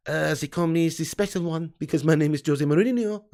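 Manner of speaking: put-on lightly Portuguese-accented Mourinho; a hint of the special one; Mourinho impression. Chat asks for Mourinho, a mild Portuguese accent. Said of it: "Do Mourinho".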